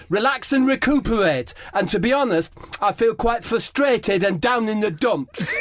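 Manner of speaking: high, prim, plaintive Harry Kane voice; Harry Kane complaining to the kiosk, voiced high and plaintive; Harry Kane impression. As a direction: whine it like a polite, sulky footballer. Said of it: "It's Harry Kane".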